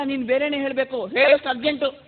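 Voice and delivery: normal talking voice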